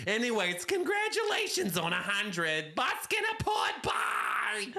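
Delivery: chatty caricature rising to a high squawk; Fran. Acted. Off-the-grid caller congratulating the hosts, Fran voice. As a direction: ramble on, then squeal the sign-off. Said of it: "Fran's off the grid".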